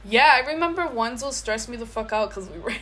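normal talking voice

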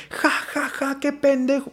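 high, soft mock jeering laugh; a hint of a mean jeering laugh. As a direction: sneer a mock ja ja. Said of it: "en un sentido culero como de".

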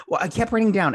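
ordinary talk

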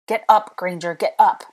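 forceful urgent order; urgent command. A narrator's reading. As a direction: call out hard to get someone moving. Rescuer calls to fallen narrator.

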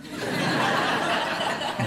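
quiet laugh at own joke; a soft unvoiced laugh; soft breathy laugh. Real, not acted. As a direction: chuckle under the breath. Joke about cats.

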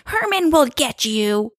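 high, drawn-out mock-spooky voice; kids' spooky warning. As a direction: sing out a slow, teasing ghostly threat. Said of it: "all the kids are like".

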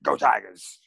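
lightly voiced fired-up coach; rally the team like a coach, lightly; Coach Owen impression. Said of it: "Coach Owen impression".

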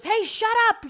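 warning shout. Retold or imitated. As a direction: yell to stop someone stepping into traffic. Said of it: "you shouted something at them".

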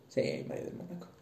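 quiet whispered confirmation; whispered repeat. Real, not acted. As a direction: whisper the name back to yourself. Repeats the name softly.

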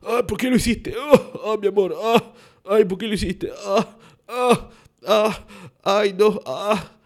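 loud, high-pitched mock wailing; hammed-up sobbing. Comic acting out a couple's intimate reunion, hammed-up sobbing. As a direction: wail through broken, exaggerated sobs. Acted sobbing scene after "Hola, pa.

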